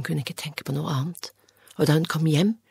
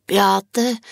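loud call up to a window; 6 semitones higher, 8 dB louder than usual. A narrator's reading. Anne calling up to Beate's window, loud shout. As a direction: shout her friend's name across the garden. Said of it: "ropte hun".

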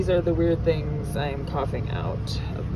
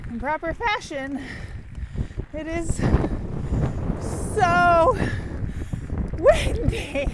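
higher and louder, over wind; shouting over wind; 9 semitones higher, 4 dB louder, slower than usual. Real, not acted. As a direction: raise voice above the wind.

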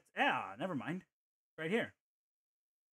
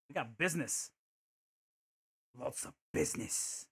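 half-hearted attempt at an Australian accent; poor Australian accent; 2 semitones lower than usual. Player joking to himself about business, poor Australian accent. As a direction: try an Australian accent and miss. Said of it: "I can't speak in a good Australian accent".

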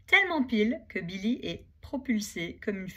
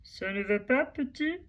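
big bison voice; 5 semitones higher than usual. Acted. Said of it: "dit-il de sa grosse voix".

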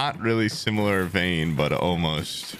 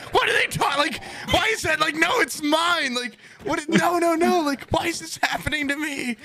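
hammed-up frantic protest, much higher; mock outraged yell. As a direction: shriek it like your work was stolen. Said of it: "I remember just being like".

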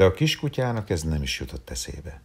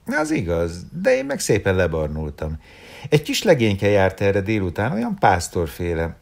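higher, louder, coquettish woman's voice; flirty woman; 5 semitones higher, 6 dB louder than usual. A narrator's reading. Malvin boasting to Dodó about an admirer, high and coquettish. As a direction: boast playfully about her tan.

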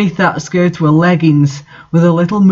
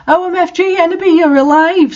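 much higher, thrilled squeal; excited scream; 12 semitones higher than usual. A narrator's reading. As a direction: shriek with delight at seeing him alive. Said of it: "screamed Vampire".

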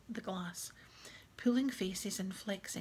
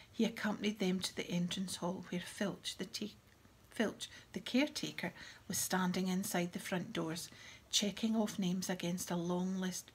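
plain reading voice

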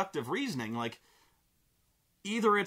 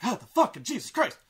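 high, jumpy yelp; mock startled; 6 semitones higher, 4 dB louder than usual. Man sees the box move again, mock startled. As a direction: jolt into alarm, playing it up.

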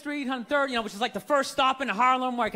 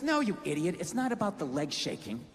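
put-on girlfriend lecturing; 5 semitones lower, 4 dB quieter than usual. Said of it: "She goes".

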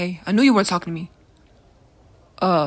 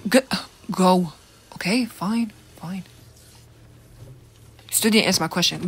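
normal talking voice

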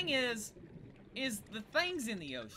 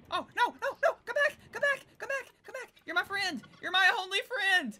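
high-pitched, hammed-up begging; mock desperate pleading; 9 semitones higher than usual. Player begs a fleeing fish to stay, mock desperate. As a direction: plead like an abandoned friend, playfully overdone.

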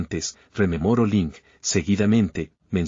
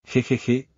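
a light sly "jejeje" chuckle; 3 semitones higher than usual. Acted. Schemer greeting Lin Fang with a light sly chuckle. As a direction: chuckle slyly, like you're plotting something.

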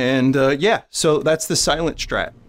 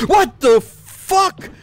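slow, high, loud disbelief; shocked yelling; 8 semitones higher, 6 dB louder, much slower than usual. Real, not acted. Player reacting to a sudden shock, shocked yelling. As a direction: stretch each word out in a stunned yell.

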